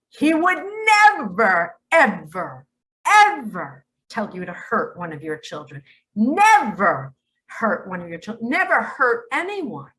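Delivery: firm insistence, leaning hard on never; emphatic never. Real, not acted. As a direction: hammer home every never, building. Insists God never harms children.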